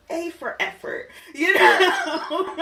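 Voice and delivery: high, laughing sarcastic praise; mock praise cracking into laughter. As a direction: tease it while laughing. Mocking weak podcasts.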